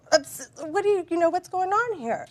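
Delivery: stumbling, high-pitched disbelief; flustered outburst. Retold or imitated. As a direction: replay being thrown and demanding answers. Retelling her shock at hang-up.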